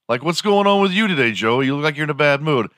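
high fast chatty friend. Pretend. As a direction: rattle it off like a nosy pal. Friend asks Joey.

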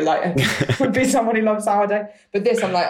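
ordinary delivery

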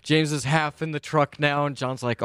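ordinary talk

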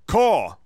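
loud human crow cry; crow caw impression. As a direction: shout a caw like a man in a tree. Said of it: "It sounds like a person going".

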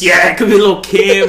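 loud, slow, emphatic agreement; excited riffing. Real, not acted. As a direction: belt it out over the laughs. Excited mocking riff with friends.

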